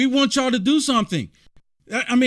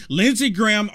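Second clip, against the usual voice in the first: usual speaking voice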